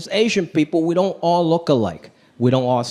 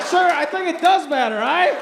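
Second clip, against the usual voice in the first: mock polite protest; 8 semitones higher than usual. Retold reply to the man.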